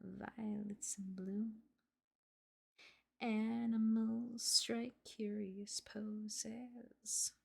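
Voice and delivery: slow, breathy whisper of the lyrics. Acted. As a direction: whisper the song words slowly, almost unvoiced. Whispering [name] lyrics along.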